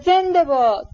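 high, drawn-out hurrah; cheering cry. A narrator's reading. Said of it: "خندید و فریاد زد".

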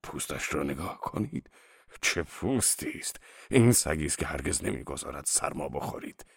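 soft, low, lightly voiced old salesman; admiring dog seller. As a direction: praise the goods softly, lingering a little. Narrator voices old dog seller praising coat.

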